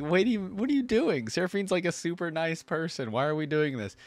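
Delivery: high-pitched, mock-indignant objection; baffled protest. Fan objecting to Gwen being mean to Seraphine, baffled protest. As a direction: protest in disbelief, pitched well up.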